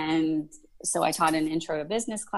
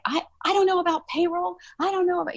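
much higher, flustered stammering; panicked; 13 semitones higher, faster than usual. Retold or imitated. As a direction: squeak it out in a panic. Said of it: "And I'm like".

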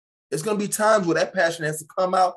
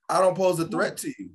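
usual speaking voice

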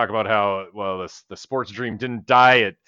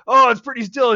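excited retelling; 13 semitones higher, 7 dB louder, faster than usual. Retold or imitated. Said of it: "And he's like".

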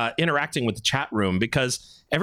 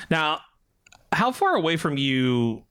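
usual speaking voice

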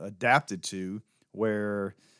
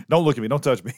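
mock panicked plea; 2 semitones higher, 5 dB louder, much faster than usual. Joking about people like his cohost, mock panicked. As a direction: recoil from everyone as if they're contagious.

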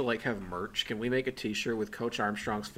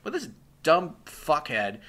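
ordinary delivery

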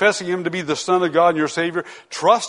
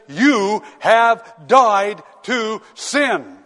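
voicing God's declaration; 4 semitones higher, much slower than usual. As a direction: announce it as God himself would, weighty and drawn out. He says.

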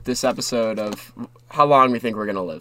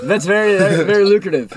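excited; 7 semitones higher, 5 dB louder than usual. Real, not acted. Excited approval of bet.

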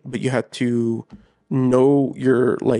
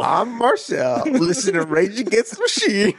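high, hammed-up show-off voice; mock cool kid; 8 semitones higher than usual. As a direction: parody a kid proud of his music taste. Mocking self-important teen Rage fan.

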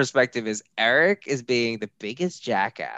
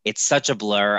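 usual speaking voice

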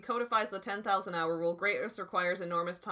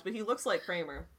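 ordinary talk

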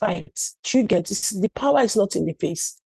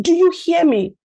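high, sharp demand for an answer; stern demand; 9 semitones higher, 5 dB louder than usual. Retold or imitated. Mother demanding her son's answer, stern. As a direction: make him answer you. Mother scolding son in story.

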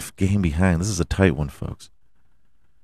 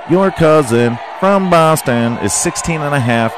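mocking jab; 7 semitones higher, 3 dB louder than usual. Jeering at Boston.

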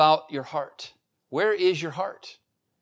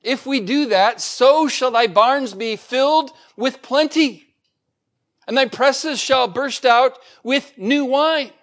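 much higher and louder fervent preaching; preaching at full force; 8 semitones higher, 6 dB louder than usual. Acted. Pastor stirring the congregation about God's promise, fervent and loud. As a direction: lift the church up to the promise.

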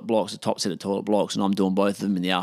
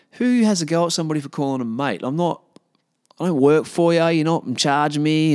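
higher, exasperated venting; indignant; 6 semitones higher than usual. Retold or imitated. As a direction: argue back in disbelief at the cheek. Indignant rant at the absent woman.

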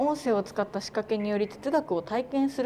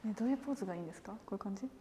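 quiet; 13 dB quieter, much faster than usual. Real, not acted. Quiet chat during photo shoot.

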